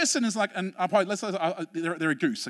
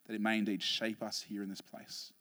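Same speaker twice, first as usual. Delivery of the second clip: soft, hushed prayer voice; a hushed pastor's prayer; 11 semitones lower, 11 dB quieter than usual. Acted. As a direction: pray it quietly, reverently. Opening prayer.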